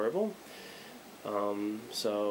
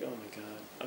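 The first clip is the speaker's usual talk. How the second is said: muttered eye-roll; 2 semitones lower, 13 dB quieter, slower than usual. Retold or imitated. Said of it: "I'm like".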